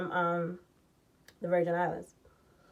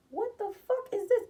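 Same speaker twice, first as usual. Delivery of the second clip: high, puzzled what-is-this; stunned disbelief; 11 semitones higher, 3 dB quieter than usual. Retold or imitated. Reading a contract handed over by her, incredulous. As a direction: squint at the paper in disbelief.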